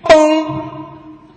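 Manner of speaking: a loud high cartoon boom; boom sound effect. Comedian voicing a pan hitting the husband, loud high boom.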